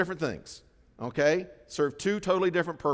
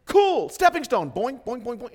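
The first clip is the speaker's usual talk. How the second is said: cheerful mountain goat; 9 semitones higher, 9 dB louder than usual. Retold or imitated. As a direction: bounce it like a carefree goat. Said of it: "A mountain goat looks at it and goes".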